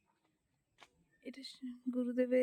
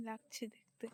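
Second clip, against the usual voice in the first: breathy whispered aside; 10 dB quieter than usual. Real, not acted. Vlogger whispering among worshippers at the ashram. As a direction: drop to a whisper at the end. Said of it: "আস্তে আস্তে কথা বলছি".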